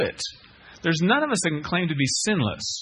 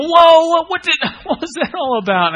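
high, loud shocked yell; mock screaming; 13 semitones higher, 10 dB louder than usual. Kicked listener reacting to the preacher, mock scream. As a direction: cry out in hammed-up pained outrage. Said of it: "and you're screaming. You go".

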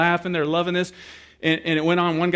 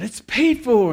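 high, raised-voice call; announcing; 8 semitones higher, 5 dB louder, much slower than usual. Retold or imitated. Guy at counter to customer, high loud announcement. Manager announcing paid food.

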